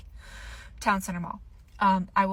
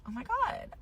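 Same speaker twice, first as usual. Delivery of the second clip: excited gasp; 8 semitones higher, 9 dB quieter than usual. Retold or imitated. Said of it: "and I'm like".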